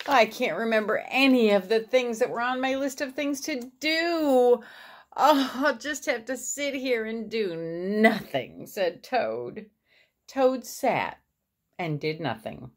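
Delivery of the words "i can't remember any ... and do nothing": high-pitched storybook whine; despairing complaint. A narrator's reading. Toad to Frog after losing the list, despairing. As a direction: lament it as a helpless Toad.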